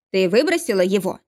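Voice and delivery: plain reading voice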